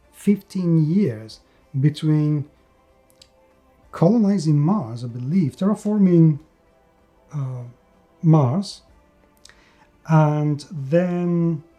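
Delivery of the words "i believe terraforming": normal talking voice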